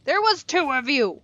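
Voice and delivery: high, loud yell at a bee. Real, not acted. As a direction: shout up at the missing bee. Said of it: "I'm yelling at a bee".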